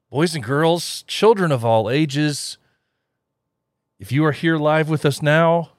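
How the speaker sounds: slow, loud circus barker; ringmaster. Pretend. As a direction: call to the crowd under the big top. Circus-style crowd announcement.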